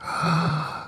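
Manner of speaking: high mock gasp of shock; a sharp high gasp at the thought of coins. Comic gasp at people still using coins.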